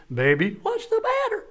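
high soft coaxing voice as the husband; tender question. Retold or imitated. Husband gently asking his upset wife, high and soft. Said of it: "And I said".

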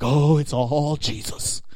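slow, syrupy mock modesty; false humility. Worship player deflecting praise, falsely humble. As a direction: drag out ALL piously, putting it on. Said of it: "people do in false humility".

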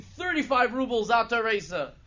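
full-force, high holler; very loud, high-pitched acted shout; screamed bid. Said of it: "And he's screaming".

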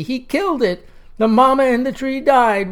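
a boy wailing in mock grief. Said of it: "wailed eight-year-old Newton Saint George".

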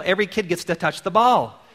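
ordinary talk

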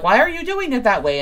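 high, loud, fast exasperated demand; mock outrage. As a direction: act up in arms, rushing it out. Acts the up-in-arms outburst.

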